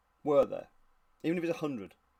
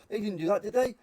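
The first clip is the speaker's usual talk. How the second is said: higher, louder mock disbelief; doubting reenactor; 7 semitones higher, 5 dB louder than usual. One reenactor questions another over the photo, mock doubtful. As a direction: question it in an acted, pitched-up voice.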